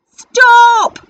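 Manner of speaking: very loud, high call. Acted. Pirate chief halts the plank, very loud high call. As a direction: shout it to halt everyone. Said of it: "called Hank Chief".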